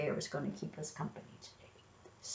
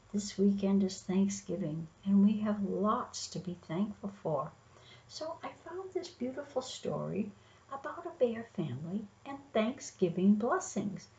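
ordinary delivery

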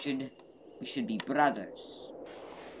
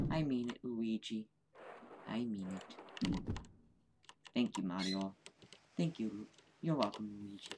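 tender; 5 semitones lower, 10 dB quieter than usual. Acted. Tender reconciliation, soft.

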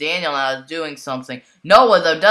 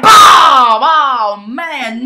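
excited shout; 8 semitones higher, 12 dB louder, slower than usual. Real, not acted. [name] fights back furiously.

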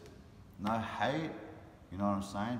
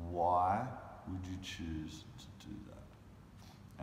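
slow pleading challenge; much slower than usual. Retold or imitated. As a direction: drag out "Why would you choose", very slowly. Repeats the challenge, drawn out.

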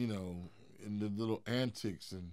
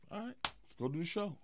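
high, lightly voiced promoter; 8 semitones higher, 6 dB quieter than usual. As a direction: toss it off in a higher voice.

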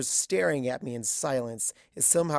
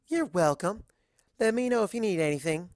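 a somewhat higher, friendly waitress; kindly waitress; 6 semitones higher, 5 dB louder than usual. A narrator's reading. Waitress advising Twilight on flirting, higher and friendly. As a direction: give friendly dating advice.

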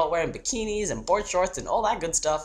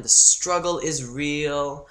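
usual speaking voice

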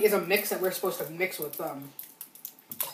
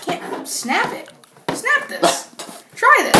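high strained voice, struggling; straining effort; 13 semitones higher, much slower than usual. Real, not acted. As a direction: strain to snap it. Struggling to snap candy.

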